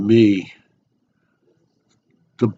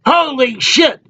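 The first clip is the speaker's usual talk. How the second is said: yelled out. Acted. Boy behind a bush calls to his friend, startled yell. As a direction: call out in shock from a distance. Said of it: "he yells out".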